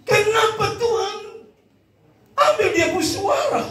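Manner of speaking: high, loud wail to God; hammed-up crying, long drawn-out protest in prayer; mock tearful wail. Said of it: "Dia menangis".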